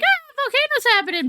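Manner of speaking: fake-panicked volcano cry; a sudden high yelp of alarm; mock panic. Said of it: "be like".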